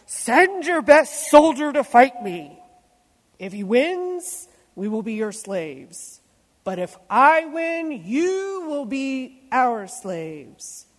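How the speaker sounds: loud booming giant challenge; giant shouting. Acted. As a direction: bellow down at the army. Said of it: "Goliath came out and shouted".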